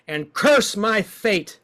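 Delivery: cursing like Job. Shown as a demonstration. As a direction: spit the curse out slowly. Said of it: "let's curse the gods like Job".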